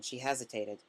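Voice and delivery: usual speaking voice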